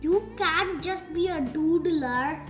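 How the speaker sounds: ordinary delivery, nothing marked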